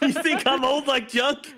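slow, high laughing yell; laughing shout. Real, not acted. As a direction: laugh it out in disbelief. Laughing incredulous question.

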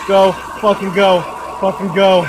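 loud frantic urging; panicking gamer. Real, not acted. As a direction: yell at yourself to hurry. Fleeing the monster.

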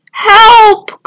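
high, loud cry for help; calling for help. A narrator's reading. Turtle stuck upside down on a rock, calling for help. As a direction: call out loudly to be rescued. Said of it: "called Tudley".

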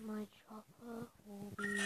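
breathy whisper. Real, not acted. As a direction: whisper it to yourself. Whispered to self.

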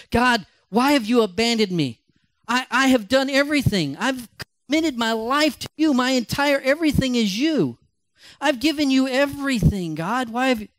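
high, pleading cry to God; anguished plea. Retold or imitated. Retold anguished prayer.

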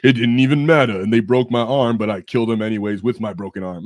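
loud, mock-heroic war story; booming tall-tale boast, easing off at the end. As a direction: brag like an over-the-top space knight.

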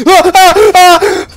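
piercing high frightened shriek; loud startled scream; real high scream. Real, not acted. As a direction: shriek in sudden terror. Spider jump scare.

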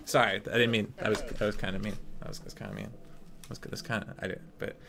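low, soft backpedalling; quiet, deflated and halting; sheepish apology. Real, not acted. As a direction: mumble an apology after overdoing it. Apologises after mocking outburst.

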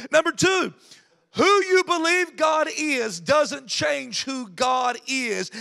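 usual speaking voice

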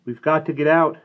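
high, loud cry to escape; urgent yell. A narrator's reading. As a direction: yell it in alarm. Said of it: "Sandy yelled".

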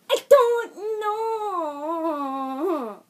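long, high wail of confusion; comic yell. Pretend. Vlogger stumped on camera, drawn-out mock yell. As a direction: yell it out very slowly, sliding down. Clowning after saying he yells.

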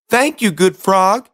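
high, bright princess. Acted. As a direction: thank it in a high voice. Princess thanks frog.

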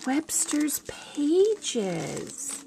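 slow breathless whisper of Webster's Pages; awed whisper. Real, not acted. Hushing the brand name over Maureen's gift, slow whisper.

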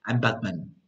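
low, very serious hero; Batman. Acted. Teacher imitating Batman from the films, low and serious. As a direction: state it gravely, pitched right down. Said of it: "el personaje muy serio, ¿verdad?".

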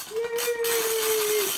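high, loud, drawn-out yay; excited cheer. Real, not acted. Host celebrates a member's weight loss, high loud cheer.

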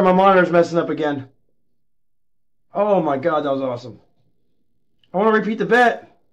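usual speaking voice

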